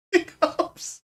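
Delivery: high, voiceless wheeze of laughter; real laughing fit. Real, not acted. Streamer saluting the joker, wheezing with laughter. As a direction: wheeze the words out through laughter. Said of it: "I'm still laughing at the first one".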